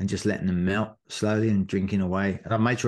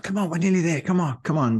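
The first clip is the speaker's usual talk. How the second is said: rally a stranger to keep running; urging runners on; 7 semitones higher than usual. Retold or imitated. Urging runners near the finish.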